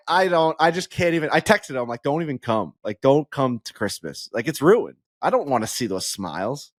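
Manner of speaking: ordinary talk, nothing marked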